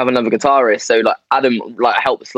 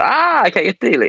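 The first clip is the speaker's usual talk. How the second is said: sudden realisation; 10 semitones higher than usual. Retold or imitated. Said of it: "And you have to".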